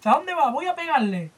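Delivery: high, yelling townsfolk; hammed-up angry villager; mock angry mob. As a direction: shout like a rabid small-town mob. Mob voiced in retold comic.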